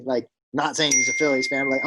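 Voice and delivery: usual speaking voice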